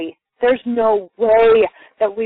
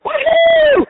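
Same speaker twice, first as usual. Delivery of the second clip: high, loud whoop of delight; shouted whoop of triumph; joyful woohoo; 6 dB louder than usual. Real, not acted. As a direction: cheer with your hands in the air. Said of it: "put my hands in the air and go".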